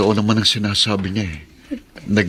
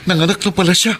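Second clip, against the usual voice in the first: high, stammering gratitude climbing higher; words tumbling out in happy shock; husband thanks the friend, overwhelmed with joy; 9 semitones higher than usual. Acted. Overwhelmed husband learns of his baby.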